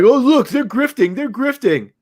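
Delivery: high mocking sneer; mock critic. Mocking the article writers who call YouTubers grifters, in a lightly sneering voice. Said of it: "I'm like".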